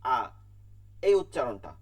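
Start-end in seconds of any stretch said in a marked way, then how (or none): 0.0-0.2 আ said clean, not through the nose; plain open আ for hamza; open a sound. Shown as a demonstration. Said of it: "নাকের মধ্যে নেই".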